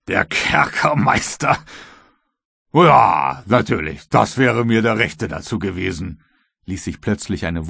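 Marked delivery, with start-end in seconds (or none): 0.1-6.2 scoffing servant. A narrator's reading. Croxton scoffs at the jailer idea, loud and mocking. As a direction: stretch the word out loudly in derision.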